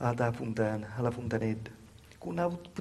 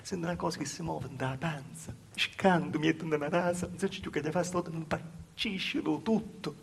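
ordinary delivery

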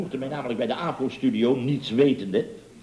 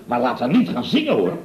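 usual speaking voice

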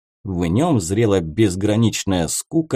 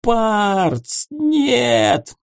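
much higher desperate cry; hoarse warning cry; 11 semitones higher than usual. A narrator's reading. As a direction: warn a friend in panic. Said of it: "прохрипел Герент".

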